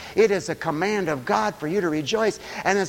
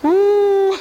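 high, loud whoop of joy; joyful whoop; 9 dB louder than usual. Real, not acted. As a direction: let out a joyful cheer. Preacher whoops.

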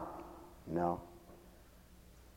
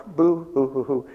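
mock boo-hoo crying; 4 semitones higher, 4 dB louder, slower than usual. Preacher acting out hearing of death day, mock crying. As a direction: pretend sobs spelt out, boo hoo.

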